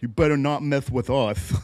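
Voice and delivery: loud, lightly voiced tough-guy threat, laughing; mock tough guys. Co-host mimics two guys making threats, mock tough. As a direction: play a goofy would-be intimidator.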